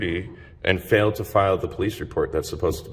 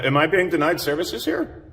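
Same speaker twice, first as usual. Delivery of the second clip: voice crack; 9 semitones higher than usual. Real, not acted. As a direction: voice cracking up high. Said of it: "Oh my God, that voice crack".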